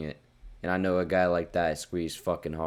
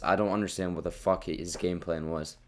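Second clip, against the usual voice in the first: normal talking voice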